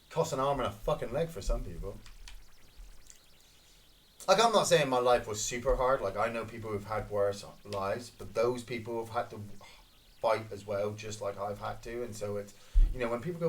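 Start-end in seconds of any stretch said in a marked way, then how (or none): none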